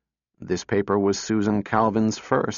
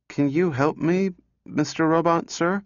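put-on little girl voice; 6 semitones higher than usual. A narrator's reading. Little girl pleads with robot.

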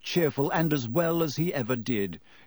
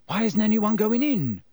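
curious; 8 semitones higher, 3 dB louder than usual. A narrator's reading. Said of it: "said Ron curiously".

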